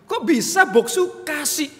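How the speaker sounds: high, slow, loud disbelief; astonished. Retold or imitated. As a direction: stretch it out, truly amazed. Puncak keheranan kepada pendeta. (heran sekali, tinggi dan lambat)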